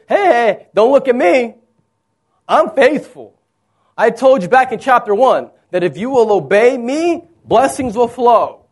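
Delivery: loud, high-pitched self-defense; indignant protest. Acted. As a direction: push back as the wrongly blamed one. God protests to Joshua, acted.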